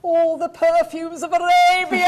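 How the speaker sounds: huge, high theatrical declamation; hammed-up stage speech. Actress showing her sitcom character's speech, wildly overacted. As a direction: ham up the tragedy at full volume. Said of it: "out, damn spot speech".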